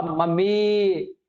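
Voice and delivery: मम्मी called with the final ई drawn out long; calling Mummy. Shown as a demonstration. Teacher demonstrates calling mother, long drawn-out मम्मी. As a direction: call मम्मी as if she is hiding in the house. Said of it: "जैसे इस प्रकार से चिल्लाते हैं ना".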